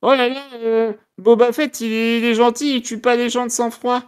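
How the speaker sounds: high, loud mock-complaining hater; haters moqués. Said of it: "Les haters". (imitation moqueuse de haters râleurs)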